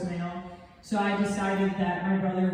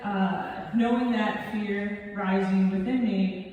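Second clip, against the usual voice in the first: ordinary talk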